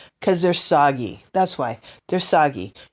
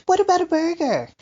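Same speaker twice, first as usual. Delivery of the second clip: cooing to the cat; 11 semitones higher than usual. Retold or imitated. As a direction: coax the cat like a baby, up high. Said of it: "She kinda knows the words".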